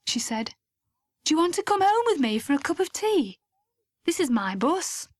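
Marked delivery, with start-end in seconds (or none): 1.2-5.1 eager invitation. Acted. Lucy eagerly invites Mike home.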